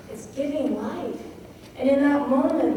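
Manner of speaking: normal talking voice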